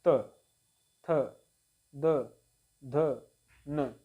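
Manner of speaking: त, थ, द, ध, न one by one, tongue against the teeth; dental consonant demonstration. Shown as a demonstration. Teacher showing students the tongue-to-teeth sounds, demonstrative articulation. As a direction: separate each dental letter with a clear pause. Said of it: "दांत से touch होता है".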